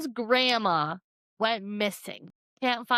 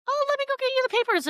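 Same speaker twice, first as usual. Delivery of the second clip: put-on chirpy desk girl; 14 semitones higher, much faster than usual.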